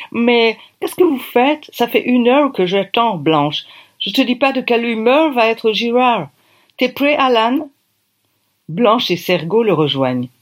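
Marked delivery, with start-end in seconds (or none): 0.1-7.7 high-pitched, hammed-up English accent in French; thick English accent. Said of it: "un accent anglish à couper au couteau". (gros accent anglais, voix haut perchée)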